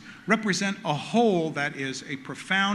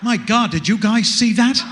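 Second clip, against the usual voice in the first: alarmed, louder, higher exclamation; startled passenger; 5 semitones higher, 5 dB louder, slower than usual. Acted. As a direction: gasp out at the near miss. Passenger seeing near miss.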